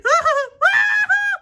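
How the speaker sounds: high, loud wordless shriek; panicked scream. Acted. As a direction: shriek as the ride takes off. Ride starts moving.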